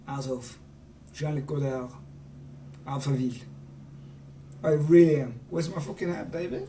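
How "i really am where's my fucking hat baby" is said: ordinary talk, nothing marked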